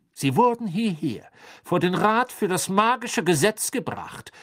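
loud, high, ringing official address; clear voice. A narrator's reading. Crouch addresses the prisoners before the magical law council, loud clear voice. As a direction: declare it loudly and clearly to the court. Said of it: "sagte er mit klarer Stimme".

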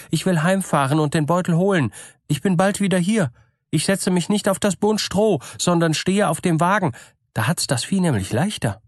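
eager to hear of her dead husband; gullible woman's voice. A narrator's reading. Woman hurries off for money. (gutgläubige Frau, eifrig und höher)

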